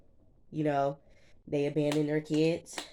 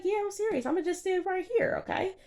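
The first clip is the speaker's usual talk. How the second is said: much higher imitation of the boyfriend, mocking. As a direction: parody his stubborn reply in a high voice. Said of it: "He's like".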